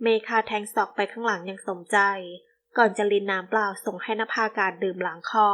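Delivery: plain reading voice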